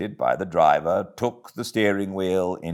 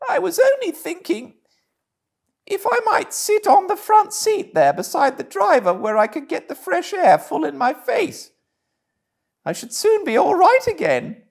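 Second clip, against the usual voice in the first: very high, meek Toad voice; playing the humble washerwoman; Toad disguised as washerwoman; 3 dB louder than usual.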